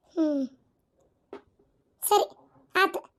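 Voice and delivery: tearful. Acted. Woman gives in tearfully.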